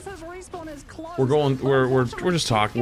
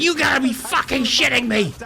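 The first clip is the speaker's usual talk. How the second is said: put-on angry old lady; 14 semitones higher than usual. Old lady cursing out the bank teller, high mock outrage. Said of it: "And she gets so angry".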